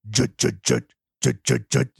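short percussive mouth-made chugs; imitated metal guitar riff, evenly punched; beatboxed riff demo. Shown as a demonstration. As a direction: chug out a rhythm with the voice. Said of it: "I want you to do, like".

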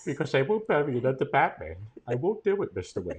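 put-on soft, emotional British butler accent; hushed British accent, falling away on the name; Michael Caine. Loyal butler refusing to bury his master, soft British accent. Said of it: "That's a great impression".

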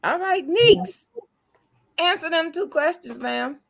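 high, loud, mock-official summons; playful courtroom announcer voice; mock stern host. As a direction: call her to the stand like a judge. Host calling her to answer.

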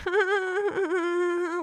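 high mocking cackle; mocking laugh. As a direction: laugh at them, high and loud. Mocking laugh after quote.

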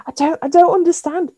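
high, outraged disbelief; mock outrage. Real, not acted. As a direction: sound appalled at the drink. Disgust at hot Bovril.

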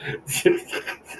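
quiet wheezy chuckle; breathy, amused snicker; laugh. Real, not acted. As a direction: laugh under your breath at your own joke. Laughs after own kayfabe joke.

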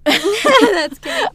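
laughing. Real, not acted. Partner laughing at reading mix-up.

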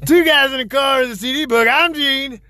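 high loud showman intro; ringmaster. Acted. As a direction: belt the title like a ringmaster. Redoing show intro.

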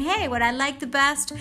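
usual speaking voice

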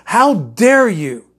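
a preacher's forceful challenge; emphatic. Acted. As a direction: drive "How" home, slowly. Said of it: "Only he has something real".

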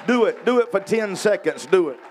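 loud high urging call; urging shout. Real, not acted. Preacher urging the congregation to praise aloud, shouting. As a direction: fire the crowd up to praise.

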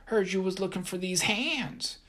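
high, cocky little voice; tiny voice shouting. A narrator's reading. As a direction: shout like a pint-sized brawler. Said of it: "a tiny voice shouted".

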